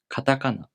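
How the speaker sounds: "Katakana" at natural speed, middle two syllables high; Japanese pitch accent. Shown as a demonstration. Teacher showing the pattern, "Katakana" with high ta-ka. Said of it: "second and third syllables are pronounced higher".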